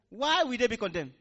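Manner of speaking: usual speaking voice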